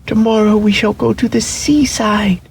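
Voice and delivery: high excited rabbit voice; rabbit's joyful thought. A narrator's reading. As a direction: cheer it out like a tiny toy rabbit. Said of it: "thought the little rabbit".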